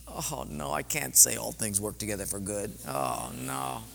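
low, soft, sinking dismay; defeated. Retold or imitated. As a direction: retell a helpless inner groan. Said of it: "I'm just so defeated".

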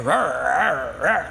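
low growling rrr; dog snarl. Retold or imitated. Dog snarling over the rabbit, low growl. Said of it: "he hears his dog snarling".

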